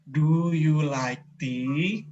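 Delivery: very slow, spelling out each word. Retold or imitated. Asking her Thai friend again, very slowly and carefully. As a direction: repeat it slowly for a confused listener. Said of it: "saya ulangin lagi, lebih pelan".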